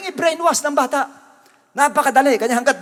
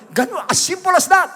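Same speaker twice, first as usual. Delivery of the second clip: emphatic exclaim; 7 semitones higher than usual. Real, not acted. Preacher's punchline.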